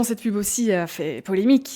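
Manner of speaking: usual speaking voice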